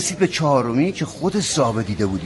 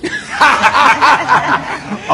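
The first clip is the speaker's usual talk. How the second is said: a high real laugh; 11 semitones higher, 5 dB louder than usual. Real, not acted. Storyteller laughing at his own name being [name]. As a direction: laugh through the punchline.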